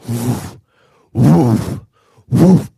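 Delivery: low, forceful airy whoosh; imitated creature noise. Imitating the noise approaching the tent, heavy whooshes. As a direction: puff out heavy wingbeat-like bursts. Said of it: "I hear this".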